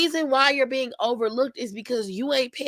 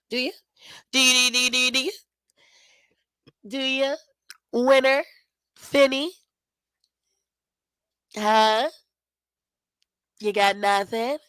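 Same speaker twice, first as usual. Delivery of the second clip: mocking pester; 4 semitones higher than usual. Liv pestering Finn for pointers, higher teasing repeats. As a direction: badger him like a bratty kid. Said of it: "She was like".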